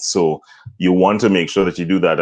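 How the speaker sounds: ordinary talk, nothing marked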